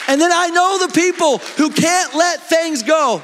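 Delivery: high, loud, slow preached cry; preaching at full force. Real, not acted. Pastor to church, shouting from the pulpit.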